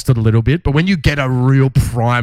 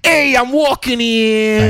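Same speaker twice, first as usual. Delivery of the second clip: put-on high, slow New York accent; a brash New York accent; 10 semitones higher, much slower than usual. New Yorker yelling at a car, slow, in a New York accent. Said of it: "a lot of New Yorkers".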